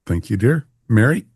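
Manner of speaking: ordinary talk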